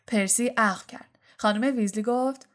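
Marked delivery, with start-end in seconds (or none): none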